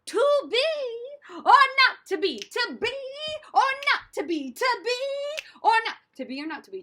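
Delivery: belted-out actor's rehearsal line; repetition drill. Shown as a demonstration. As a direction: drill the line boldly, over and over. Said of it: "You would repeat it again and again".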